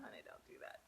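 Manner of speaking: unvoiced whisper to herself. Real, not acted. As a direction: whisper a self-scolding. Scolds herself after swallowing wrong.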